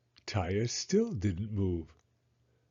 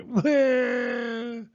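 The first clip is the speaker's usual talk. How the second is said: wailing cry; 12 semitones higher than usual. A narrator's reading. Girl bursts into tears.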